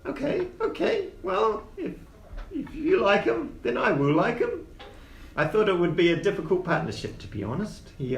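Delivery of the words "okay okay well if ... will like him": high little gnome voice; gnome character. Acted. Gnome agrees to like the partner, high gnome voice. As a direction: concede in a high gnome pitch.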